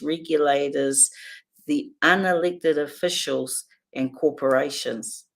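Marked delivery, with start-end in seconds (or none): none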